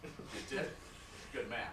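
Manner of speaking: low-pitched, buddy-buddy attorney voice; chummy lawyer. Acted. Lawyer chatting to client about a cocaine bust, chummy. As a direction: play the genial lawyer telling a case story.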